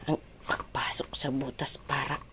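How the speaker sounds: breathy whisper to herself; soft unvoiced aside while concentrating. Real, not acted. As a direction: murmur it under the breath. Whispers while fitting the part.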